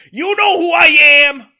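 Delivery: loud, high full-throated outburst; over-the-top acted shout; movie-scene yelling. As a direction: belt it out like a hammed-up movie cop. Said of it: "is yelling at Anthony Anderson".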